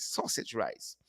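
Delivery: breathy hushed relish of the dish name; savoring whisper. Real, not acted. Host to viewers, savoring a whisper. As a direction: whisper it like tasting it. Savoring a menu item aloud.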